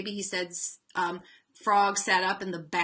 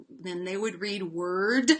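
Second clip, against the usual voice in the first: slow, carefully sounded "word" with a rise; modelled reading; 2 semitones lower, slower than usual. Shown as a demonstration. Teacher modelling how the student reads the revealed word. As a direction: say "word" slowly as the student would. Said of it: "and then they would".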